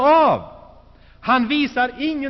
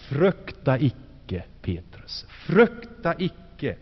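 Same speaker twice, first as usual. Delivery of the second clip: lower, slow and soothing; calming; 7 semitones lower, slower than usual. Acted. Jesus calms the kneeling Petrus, low and slow.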